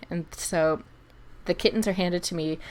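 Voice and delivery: ordinary talk, nothing marked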